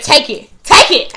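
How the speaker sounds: retold furious shout; angry yell. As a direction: fling the words at her. Throwing bag at customer.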